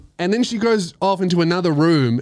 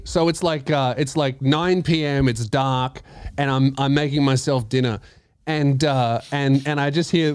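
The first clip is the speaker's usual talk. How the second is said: ordinary talk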